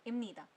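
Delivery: इमनीदा said with m, imnida; इमनीदा, the p turned to m; spoken form. Shown as a demonstration. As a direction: Korean 입니다 read as इमनीदा. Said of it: "पढ़ोगे".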